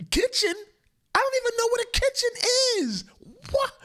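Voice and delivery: put-on very high, whining little-kid voice; whiny child denying. Kid caught sneaking food at night, whining high denial. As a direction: plead innocence like a caught kid.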